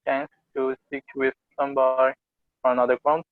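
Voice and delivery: ordinary talk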